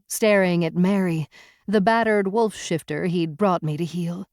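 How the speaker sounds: plain reading voice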